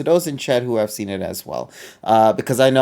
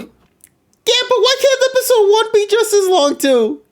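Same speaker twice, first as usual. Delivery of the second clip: whiny. Real, not acted. Whiny high protest.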